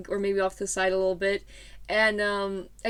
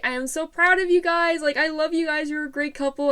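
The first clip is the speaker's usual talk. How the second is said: high, bright-eyed praise, a touch louder; gushing teacher; 6 semitones higher, 3 dB louder than usual. Retold or imitated. P.E. teacher gushing proudly over the couple. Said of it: "she was like".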